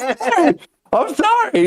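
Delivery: high, giggly I'm sorry; laughing apology. Retold or imitated. Apologising to the coworker through laughter, high. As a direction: apologise while cracking up. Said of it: "I was like".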